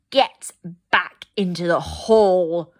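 loud, slow furious command; a cold queen snarling an order; snarled. A narrator's reading. Snow Queen ordering the children back, furious loud snarl. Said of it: "she snarled".

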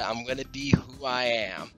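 ordinary delivery

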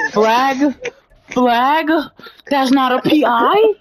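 high, loud incredulous questions; indignant. Real, not acted. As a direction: demand the flag in disbelief. Demanding penalty flag.

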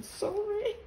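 very high, small-voiced sorry; sheepish apology. Real, not acted. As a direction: squeak out a little apology. Playful apology for her pronunciation.

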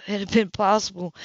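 usual speaking voice

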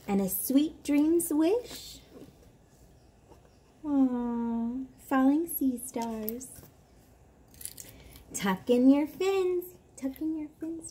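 put-on slow, lilting read-aloud for a toddler; bedtime story reading. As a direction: read it like lulling a child to sleep. Reads bedtime book aloud.

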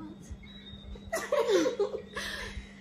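loud high laugh. Real, not acted. Laughs at sister.

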